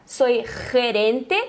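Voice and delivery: "gerente" begun with a drawn, rasped throat H; guttural G. Shown as a demonstration. Teacher repeats the job title for learners, guttural G. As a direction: hold the throaty scrape starting "gerente".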